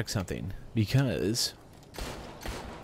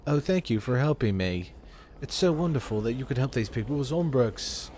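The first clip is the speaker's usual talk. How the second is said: mock British game character; polite British thanks with little real feeling; neutral British accent; 4 semitones higher than usual. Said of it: "British sounding person just sound so neutral".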